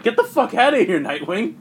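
high, loud retold order to leave; mock angry outburst. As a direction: act out a furious dismissal, voice jumping up. Retold character angrily ordering someone out.

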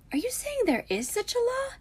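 put-on very high-pitched girlish question; wide-eyed wonder. A narrator's reading. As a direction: lift it right up in amazement. Luna wide-eyed, amazed.

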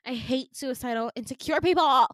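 loud, rising exasperated outburst; mock exasperation. Staffer fed up with an insecure patient, mock exasperated outburst.

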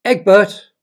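loud high call across the hall. A narrator's reading. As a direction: summon him loudly. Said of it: "she called".

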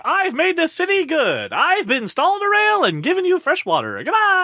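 Thomas Wayne on TV. Retold or imitated. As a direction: deliver it like a smug public address. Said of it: "on a T V screen ... going".

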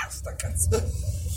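words squeezed out mid-cough. Real, not acted. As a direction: struggle through a cough. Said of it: "tosse di merda". (voce rotta dalla tosse)

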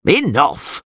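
high-pitched command to stop; yelled. A narrator's reading. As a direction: call a halt to the crowd. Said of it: "yelled Caldermain".

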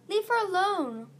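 high, loud protest; urgent cry. A narrator's reading. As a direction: shout it high in someone's defense. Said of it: "Starflight cried".

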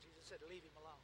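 very low, soft aside; quiet, understated authority; hushed retelling. Acted. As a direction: drop right down after the shout. Quiet answer after the shushing.

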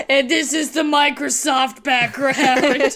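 loud, slow, laughing punchline. Real, not acted. Joking with friends about the fake-looking background, laughing loudly. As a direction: crack up while landing the joke.

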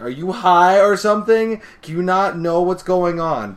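high, loud disbelief; mock-incredulous yelling; exasperated shout. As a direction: berate someone oblivious to danger. Acted exasperated girl shouting.

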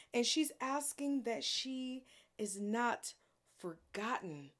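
usual speaking voice

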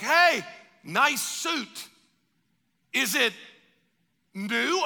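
slow, stretched "Hey, nice suit"; drawn-out compliment. Pretend. Greeting a friend in heaven, slow and admiring.

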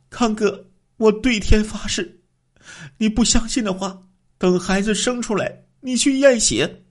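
high woman's voice, swearing earnestly; the wife swears on heaven to her husband, high and earnest. A narrator's reading.

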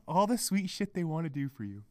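low, soft dismissive remark; subdued scorn. Real, not acted. Podcaster remarking on partners' sweet gestures, subdued scorn. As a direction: say it under the rant, softly. First, subdued take of the phrase.

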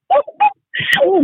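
high exasperated exclamation; exasperated outcry. Real, not acted. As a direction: pitch jumps very high on the oh. Exasperated outburst about the show.

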